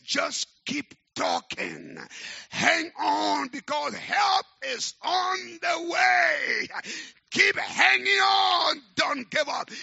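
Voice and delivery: the voice of God roared high and loud, slowly; urgent shout. Acted. Preacher thunders God's words to the congregation, full shout. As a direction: shout hope into the room, drawn out. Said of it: "But the voice says".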